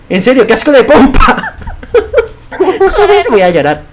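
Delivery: high, loud laughing complaint. Real, not acted. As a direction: laugh through the curse. Laughing at own fail. (quejándose entre risas, alto y agudo)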